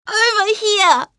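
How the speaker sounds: high, loud, slow yell. Acted. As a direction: gather all your courage and yell. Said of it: "she took a deep breath and yelled".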